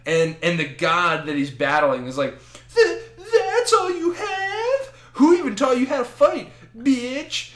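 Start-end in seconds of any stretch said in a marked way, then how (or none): 2.7-7.5 very high, strained mock cry; battered god's taunt. Beaten god still taunting Frank, high strained mock bravado.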